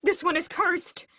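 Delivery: high, loud cry of alarm; sudden alarmed exclamation; exclaimed. A narrator's reading. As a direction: blurt it out in shock. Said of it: "someone exclaims".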